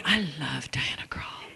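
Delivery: unvoiced, slow whisper. Father confessing his love for [name], whispered. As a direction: whisper it like a secret crush. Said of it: "He's like".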